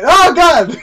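high, very loud cry of dismay; alarmed yell. Real, not acted. Player mid-crash in a game, alarmed yell. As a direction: shout it as disaster hits.

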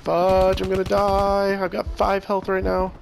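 about to die, voice rising; panicking gamer. Real, not acted. Low health, about to die.

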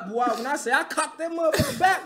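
ordinary delivery

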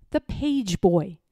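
usual speaking voice

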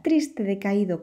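usual speaking voice

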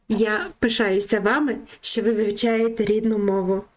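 normal talking voice

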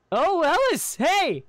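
high, loud hello; startled greeting. Acted. Adventurer noticing the scolding friend, startled. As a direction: blurt a greeting, suddenly loud.

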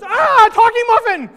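a muffin squealing in shock; startled muffin. Acted. Second muffin panics at the talking muffin, high shriek.

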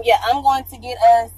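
usual speaking voice

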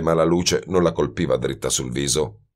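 ordinary delivery

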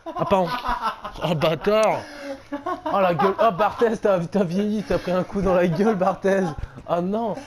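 loud laughing curses after a scare; laughing shouts. Real, not acted.

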